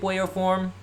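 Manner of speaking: ordinary delivery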